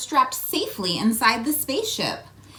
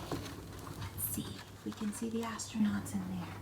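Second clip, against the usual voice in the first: soft secret whisper; 14 dB quieter than usual. Real, not acted. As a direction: whisper as you peek in. Peeking in secret.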